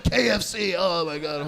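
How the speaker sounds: hammed-up moan sinking away; put-on cry of ecstasy; mock lover's cries. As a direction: imitate a lover moaning in passion. Mimicking a Deep South lover in sex.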